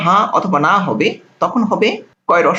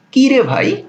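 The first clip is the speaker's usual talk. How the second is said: playful exclamation; 6 semitones higher, slower than usual. Pretend. Teacher mock-exclaiming to students.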